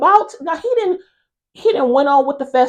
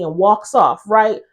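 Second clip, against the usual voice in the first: usual speaking voice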